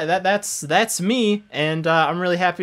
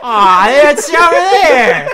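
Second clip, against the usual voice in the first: raspy voice with eagle shrieks; 11 semitones higher, 12 dB louder, slower than usual. Acted. Continues the Griffin voice bit.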